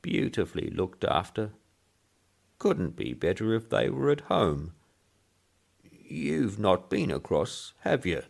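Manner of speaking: put-on high thin old man's pipe; piping old voice. Old man praising the cemetery, piping.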